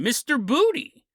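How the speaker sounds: normal talking voice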